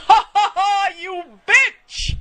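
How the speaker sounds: high, loud mock cackle into a jeer; gloating yell. Driver yelling in the car after keeping the change, gloating. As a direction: crow it out, re-enacting a triumphant yell. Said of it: "I was yelling that out".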